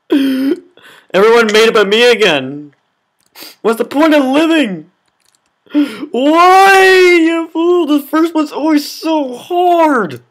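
theatrical woe at a video game; mock despair. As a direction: play the tragic hero, overdone. Mock-dramatic despair over failing.

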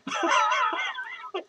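long, high cackling laugh; cackle. Real, not acted. Laughs after begging for help.

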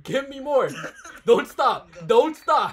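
high, loud coaching shouts; urging on. Real, not acted. Egging on someone doing a count.

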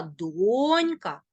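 донька with drawn-out stressed донь; stress on first syllable. Shown as a demonstration. Teacher demonstrating first-syllable stress. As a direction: slowly stretch донь in донька. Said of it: "наголос на перший склад".

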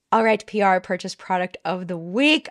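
breathy announcer whisper; hushed show intro; whispered segment title. Acted. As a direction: whisper the segment name like a secret.

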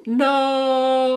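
high, loud cry of alarm; shouted no. Acted. Teacher shouting in mock danger, loud cry. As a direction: yell it out in sudden danger.

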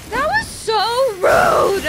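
very loud, high-pitched indignant yell; outraged shout. Real, not acted. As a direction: shriek it at the game, offended. Outraged at the game's attack.

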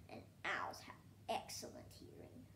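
hushed unvoiced whisper. A narrator's reading. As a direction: breathe it like a secret. Fact said twice, hushed first.

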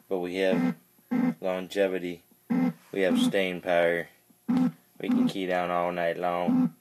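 ordinary talk, nothing marked

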